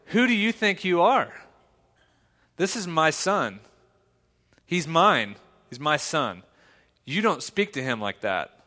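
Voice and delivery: firm fatherly confrontation. Retold or imitated. Dad confronts the boy bullying his son, loud and stern. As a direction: lay down the law to the bully. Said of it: "but I basically said".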